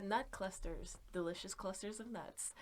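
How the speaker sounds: creepy soft sell of nut clusters. Acted. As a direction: breathe the words like a secret ad. Slogan for the stream killer.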